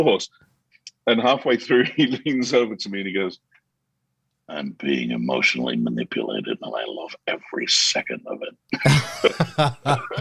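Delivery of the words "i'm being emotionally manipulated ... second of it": low confiding aside. Retold or imitated. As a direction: drop deep and confide it like a secret mid-film. Banks leans over in cinema.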